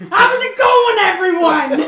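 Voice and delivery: high, booming comic greeting; loud Gilbert Gottfried. Acted. Entering so everybody knows you're there, yelling. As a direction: announce yourself to the whole room. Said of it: "everybody would know that you're in the room".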